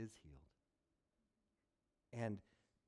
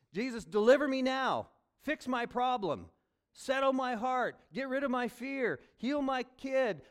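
desperate loud plea; 11 semitones higher, 10 dB louder than usual. Acted. Preacher voices desperate prayer.

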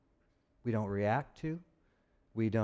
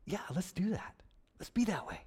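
airy whisper of "Yeah, let's do that"; 5 semitones higher, 6 dB quieter than usual. Retold or imitated. Pastor voicing the apostle as a hushed aside, whispered. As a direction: breathe it out softly, unvoiced. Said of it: "he says".

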